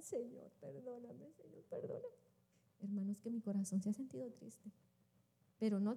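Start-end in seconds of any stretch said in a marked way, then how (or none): 0.0-2.2 very soft, breaking into near-whisper; hushed mock sobbing prayer; imitated tearful plea. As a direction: re-enact weeping as you beg forgiveness. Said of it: "empiezo a llorar".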